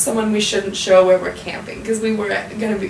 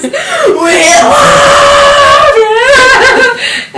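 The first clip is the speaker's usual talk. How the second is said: huge, much higher, louder sung shout, drawn out long; full-lung yell; 14 dB louder, much slower than usual. Retold or imitated. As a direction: let it rip and deafen everyone. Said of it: "he let it rip".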